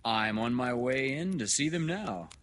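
loud, high, hearty doctor; hearty good news. Acted. Doctor reassuring new father about triplets, loudly hearty.

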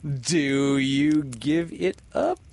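slow, teasing crow. A narrator's reading. Peter dares the pirates to give up, crowing. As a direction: draw the challenge out slowly and gleefully. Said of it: "crowed Peter".

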